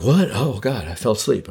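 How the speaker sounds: mock waking up. Acts waking from a doze.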